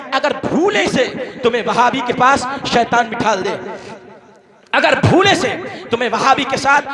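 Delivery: ordinary delivery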